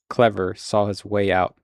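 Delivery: usual speaking voice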